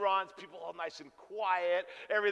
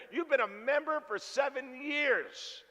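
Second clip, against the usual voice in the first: slow emphatic news; 6 semitones higher, slower than usual. Retold or imitated. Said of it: "She goes".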